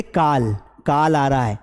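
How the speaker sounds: कॉल said as "kaal" with long aa; mock the flat-out "kaal" for कॉल; mispronounced call. Shown as a demonstration. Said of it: "पप्पा वाली English". (कॉल को "काल" बोलना)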